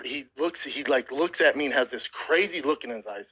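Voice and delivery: normal talking voice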